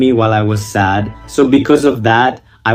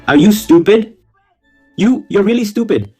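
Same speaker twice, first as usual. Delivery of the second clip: scolding; 10 semitones higher than usual. Real, not acted. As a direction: call them stupid in disbelief. Scolding the confessor.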